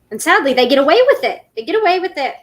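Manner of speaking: fed-up rant; indignant outburst. Real, not acted. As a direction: vent it out indignantly. Upset about a scam.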